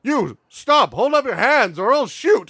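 imitated bandit shouting a threat; bandit's warning. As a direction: call out a hold-up order. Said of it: "all I hear is".

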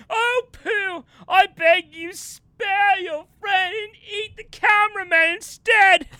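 high, loud stage plea; melodramatic begging. Acted. Actor offering the cameraman to the bear, overacted begging. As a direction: beg like a hammy tragedian. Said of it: "he'll be like".

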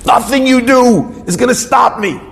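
high-pitched, resolute declaration of defiance; defiant cry. Retold or imitated. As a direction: call it out high, refusing to be beaten. Husband defies Satan.

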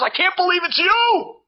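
high, loud squeal of joy; wife squealing at her old schoolmate, excited; excited shriek. Retold or imitated.

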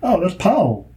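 high, exaggerated Southern drawl. Shown as a demonstration. Demonstrating the dog pun, Southern drawl. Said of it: "a southern drawl where you're like".

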